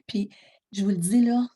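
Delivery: ordinary delivery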